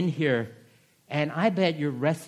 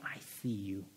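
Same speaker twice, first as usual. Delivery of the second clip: soft, lower, gentle reassurance; tender God voice; 8 semitones lower, 13 dB quieter than usual. Acted. God comforting the suffering listener, softly. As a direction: say it quietly like comfort. God answering those in pain.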